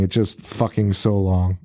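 ordinary talk, nothing marked

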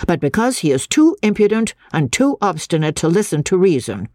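stern lecturing schoolmaster; strict teacher. Acted. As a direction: scold the class like a stern master.

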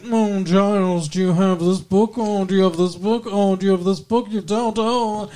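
put-on snooty British accent; condescending British lady accent. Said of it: "she's very British".

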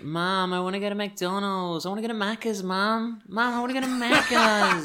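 put-on high, whiny Australian child's "Mom, I wanna go to Macca's"; Australian kid accent. Child nags his mum in an Australian accent, high and pleading. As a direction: beg like a little Aussie kid. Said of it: "I can literally only say".

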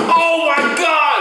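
high, drawn-out shout of awe; amazed outcry. Retold or imitated. Telling how he watched the show, amazed shout. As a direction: cry out in disbelief, stretched slow.

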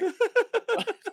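high squealing laugh. Real, not acted. Laughing at super chat.

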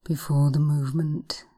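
slow, hushed hypnosis voice; breathy guided relaxation, unhurried. Acted. Hypnosis session guiding relaxation.

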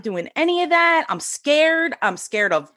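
high, whiny scared refusal; mock scared protest. Refusing to be a healer, mock scared whine. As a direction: play her younger self squirming away from it. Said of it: "And I'm like".

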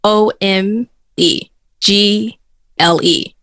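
slow, letter-by-letter spelling; one letter at a time, slowly. Shown as a demonstration. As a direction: spell it out with gaps between letters. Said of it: "Spell it for me".

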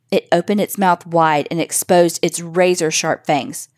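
ordinary delivery

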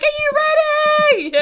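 very high, slow, loud hype call; Tammy hyping crowd. Acted. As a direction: stretch it out to pump the crowd. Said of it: "she's like".

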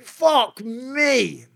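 high, strained disbelief; high-pitched stunned curse; stunned dismay. Retold or imitated. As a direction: squeak it out in shock. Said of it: "I'm like".

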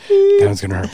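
very high, loud squeal; squeaky ringtone line. Shown as a demonstration. Host hamming up the line for a friend's ringtone, very high. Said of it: "It's gonna be my ringtone".

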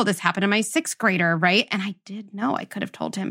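normal talking voice

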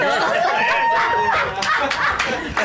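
high chipmunk giggle; squeaky helium laugh. Real, not acted. Laughs after breathing helium.

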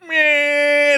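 long, high buzzer bleat; door buzzer. Retold or imitated. Elevator warns the door is held, buzzer imitation. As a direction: drone like an elevator alarm. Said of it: "like, it buzzes you".

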